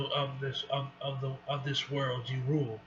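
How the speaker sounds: usual speaking voice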